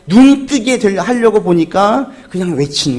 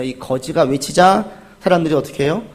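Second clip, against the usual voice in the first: ordinary talk, nothing marked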